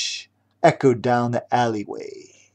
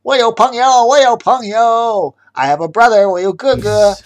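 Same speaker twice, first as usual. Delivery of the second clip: loud boasting villain; 8 semitones higher, 7 dB louder than usual. Acted. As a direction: boast about scary friends. Chef boasting threats.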